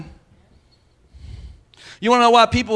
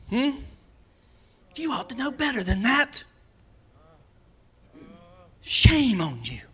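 slow, judgmental scolding with a mocking laugh; slower than usual. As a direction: play the self-righteous churchgoer.

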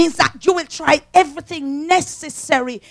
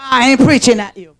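ordinary talk

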